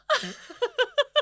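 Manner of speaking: loud high honking squawk; goose honk. Pretend. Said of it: "do my goose impression".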